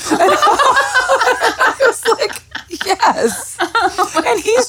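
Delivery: high, laughing through the words; can barely get it out for laughing; laughing hard. Real, not acted. Laughing while retelling the show.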